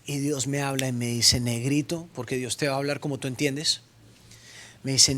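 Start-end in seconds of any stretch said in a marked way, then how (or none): 1.4-2.1 a touch lower, soft and fatherly; lightly voiced God. God calling the preacher home, lightly lower voice. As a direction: call him gently, like God.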